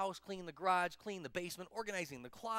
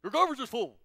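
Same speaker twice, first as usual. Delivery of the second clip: loud, booming statesman impression; big presidential voice, raised; President Obama; 5 semitones higher, 12 dB louder than usual. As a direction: boom it out as a helpful dignitary. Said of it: "the president goes".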